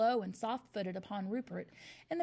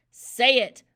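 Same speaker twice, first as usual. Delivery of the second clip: much louder roar; bellowed; 17 dB louder than usual. A narrator's reading. Headmistress demanding an answer, bellowing. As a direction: bellow the order. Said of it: "bellowed the Trunchbull".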